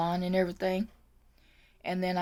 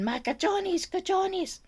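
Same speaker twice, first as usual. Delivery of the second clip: imitated Spanish-speaking lady; 10 semitones higher, slower than usual. Aide recalling what the patient kept saying, high mimicry. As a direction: mimic her plaintive repeating, pitched way up.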